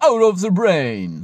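high, loud and slow, as if reversed; "out of the brain" sung out high and slow like reversed tape; backwards speech. Shown as a demonstration. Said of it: "So muss ich jetzt out of the brain auch sagen".

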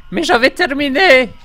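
high, loud distressed yell; calling for rescue. Pretend. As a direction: shout up in pitch for a teammate to save you. Calls teammate for rescue in game.

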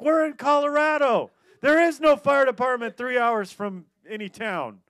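yelled high and loud; shouted reply. Retold or imitated. Friends yelling back across the river, exasperated shout. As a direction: shout the bad news across the water.

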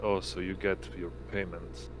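ordinary talk

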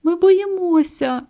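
high, slow scared cry; frightened chicks. A narrator's reading. Chicks telling mother they are afraid of the thunder, high. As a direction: say it as a frightened little bird.

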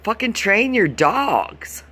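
high, slow, fed-up retort; exasperated snap. Retold or imitated. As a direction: spit it out slowly, sky-high and fed up. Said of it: "I'm like".

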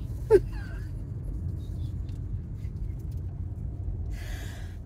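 a long, high, breathy burst of laughter. Real, not acted. Amused by the lady's slip, laughing high and breathless. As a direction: laugh out helplessly, almost silent.

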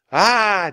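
high, loud "Ah!" of alarm; yell. A narrator's reading. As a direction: yell out as the ground drops away. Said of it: "Jack yelled".

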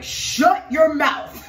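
lightly voiced Jesus shutting down the devil; Jesus rebuking. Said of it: "And Jesus will be like".

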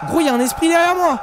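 loud, high panic; panicked. Real, not acted. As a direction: shout that it's behind you. Ghost right behind him.

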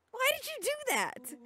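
high-pitched, incredulous question; aghast outcry. Acted. Friend reacting to the run into the fence, aghast. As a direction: demand it in a high, startled voice.

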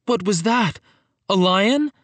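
high, alarmed question; startled question. Acted. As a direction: ask it startled, voice jumping up. Startled kids hear a cry.

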